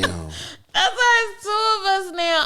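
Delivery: high slow laughing repeat. Real, not acted.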